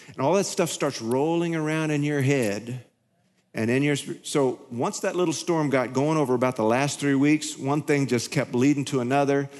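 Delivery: ordinary delivery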